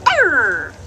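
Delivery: rising mock pirate growl; mock pirate arr. Shown as a demonstration. Owner shows the parrot a pirate arr. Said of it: "Let's try the pirate go".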